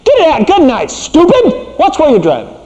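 high, mock angry yelling; hammed-up insulting yell; rude insult. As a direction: snap at Tim like a road-rage driver. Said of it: "I turned towards you and I said".

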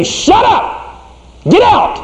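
high mock yell; angry husband. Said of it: "you spoke angrily to your wife".

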